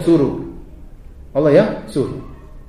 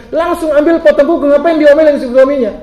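much higher, louder exhortation; preaching; 10 semitones higher, 7 dB louder than usual. Acted. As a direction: press the point with fervour. Preaching at full force. (seruan khotbah yang lantang)

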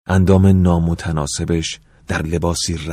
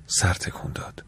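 soft unvoiced whisper, much softer; whispered narration; 8 dB quieter than usual. A narrator's reading. Narrator recalls her nodding, whispered. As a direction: drop to a whisper for this line. Same words read twice.

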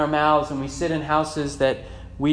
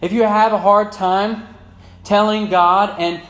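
loud, raised exhortation; forceful preaching; 6 semitones higher, 7 dB louder than usual. Real, not acted. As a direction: press the congregation hard on the point.

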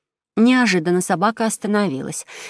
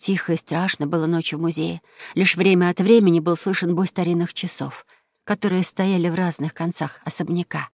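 usual speaking voice

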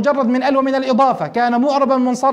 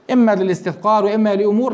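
ordinary talk

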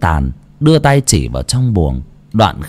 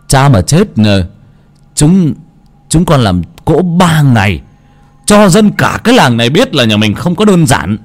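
boastful, rising higher and louder to the end; khoe khoang hăng hái; 8 semitones higher, 5 dB louder than usual. Acted. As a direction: brag it out to the whole village. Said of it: "khua chân múa tay". (con cả khoe đám ma to, giọng cao vống lên)

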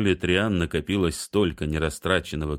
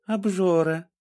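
teasing; 13 semitones higher than usual. A narrator's reading. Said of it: "усмехнулась Триан".

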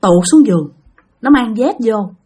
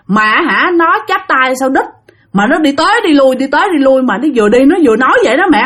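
ordinary delivery

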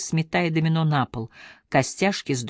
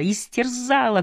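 истерзала repeated much higher, cried out and falling; anguished shout; 8 semitones higher than usual. A narrator's reading. Randall repeating истерзала at Anne, higher and anguished. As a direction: throw истерзала up high like a wounded cry. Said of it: "крикнул Рэндалл".

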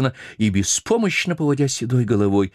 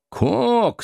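bossy sailor. Acted. Stubb calls the cook.

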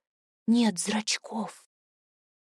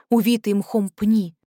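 ordinary delivery

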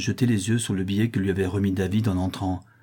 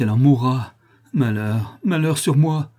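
raised voice of anguish; despairing outcry; 4 semitones higher, 6 dB louder than usual. A narrator's reading. Father fearing his daughter will die, despairing. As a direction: lament it, surging on the last words.

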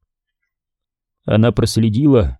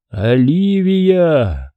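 very slow, drawn-out name; long, haunting summons; eerie calling voice; 4 semitones higher, much slower than usual. A narrator's reading. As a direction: call her from beyond, stretching every vowel. Disembodied voice calls her name.

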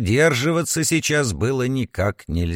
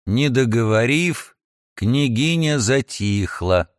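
ordinary delivery, nothing marked

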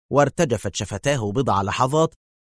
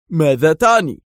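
high, loud challenge; sharp; 6 semitones higher, 6 dB louder than usual. A narrator's reading. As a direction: demand it sharply, voice jumping up. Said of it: "قائلا في حدة".

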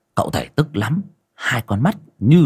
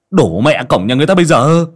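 sharp rebuke; 6 semitones higher, 6 dB louder than usual. Acted. Master rebukes gate-kicking medium.

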